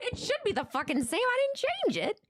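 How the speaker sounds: high, incredulous protest; baffled protest. Real, not acted. Streamer insisting to viewers nothing was changed, high baffled protest. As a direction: protest with pitch shooting up.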